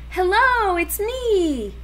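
high, loud hopeful call. A narrator's reading. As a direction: call out eagerly to a friend. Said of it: "he called".

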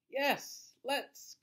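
high excited yes; eager cry. Acted. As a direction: jump at the idea. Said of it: "cried the skeleton".

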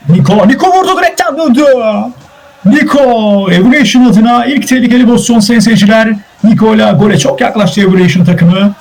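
high-pitched excited match commentary; excited play-by-play. Real, not acted. Commentator telling viewers of a shot off the post, excited.

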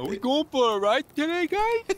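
very high, squealy horse voice; Sally the horse impression. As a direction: do an excited, high-pitched talking horse. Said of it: "that's how Sally talked".

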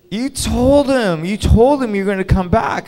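stretched-out imitated whine of protest; insistent protest. Girlfriend insists, retold.

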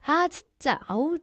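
ordinary talk, nothing marked

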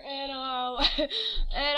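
ordinary talk, nothing marked

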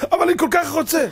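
loud, high-pitched whining plea; mock whine of someone who must have it; whiny wanting. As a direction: beg like a spoiled child. Mimicking a wanting person.

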